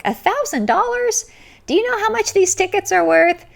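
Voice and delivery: high, loud, incredulous bantering jab; mock outrage. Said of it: "Jerry West pipes and he's, he's like".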